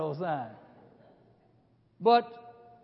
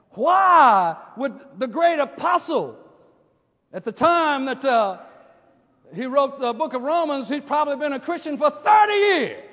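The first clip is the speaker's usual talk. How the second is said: louder, rising preacher's cadence; preaching at full force; 6 semitones higher, 10 dB louder than usual. Acted. Preacher telling the congregation of Paul's trials, preaching hard. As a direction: build the sermon, pressing every phrase.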